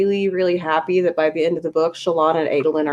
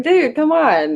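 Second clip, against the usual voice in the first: exasperated; 9 semitones higher, slower than usual. Retold or imitated. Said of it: "it was like".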